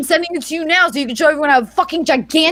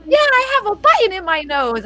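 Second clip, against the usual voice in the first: put-on high-pitched, excited little boy; bubbly child voice, pitched up; excited kid; 8 semitones higher than usual. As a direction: imitate your kid proudly announcing it. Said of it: "He's all excited".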